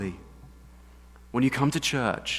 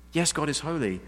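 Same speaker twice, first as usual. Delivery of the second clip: ordinary delivery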